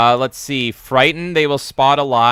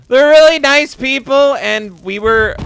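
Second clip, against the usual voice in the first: high, loud, stretched out; shocked yell; 12 semitones higher, 8 dB louder than usual. Real, not acted. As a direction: keep yelling in shocked disbelief. Shouted right after dying in game.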